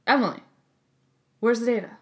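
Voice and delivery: lightly mimicking the boss, curt; a hint of a blunt boss asking; boss's curt demand. Imitating boss Daniel demanding the data, lightly. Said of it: "Just like that".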